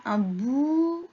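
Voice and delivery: "bouche" slowly drawn out; sounding out. Shown as a demonstration. Teacher hints at the last drawing's word, sounding it out. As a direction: stretch the vowel of "bouche".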